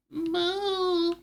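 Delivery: high, long held moo; cow moo. Pretend. Streamer showing off the new accent, high cow moo. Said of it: "I found my new accent".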